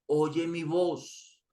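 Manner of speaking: very slow and soft, a hint of Jesus; tender invitation. Jesus invites Pilato to hear him, very slow and soft. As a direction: draw it out softly like a calling.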